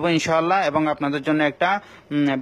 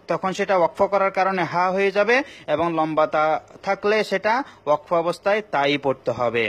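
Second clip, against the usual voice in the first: ordinary delivery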